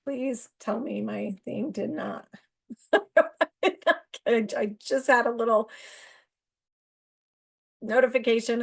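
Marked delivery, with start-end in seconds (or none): 2.7-4.2 sudden high laugh bursting out; burst of laughter. Real, not acted. Vlogger laughing at a phone notification, high burst of laughter. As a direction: laugh out loud at the surprise.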